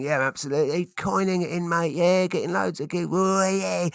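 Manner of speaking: hammed-up showing off; boastful bragger. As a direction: crow about your success like a mock braggart. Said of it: "don't wanna be a bragger".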